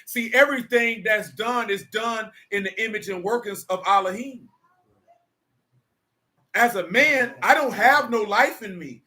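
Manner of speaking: full-force pulpit cadence; preaching. Acted. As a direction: preach it slowly, leaning on every phrase. Returns to preaching the word.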